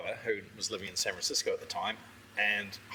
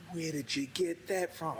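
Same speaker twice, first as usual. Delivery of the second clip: higher, slow, wide-eyed wonder; slow amazed question; 6 semitones higher, slower than usual. Retold or imitated. Amazed friend asking about a rare cellar bottle, slowly. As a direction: draw it out in amazement.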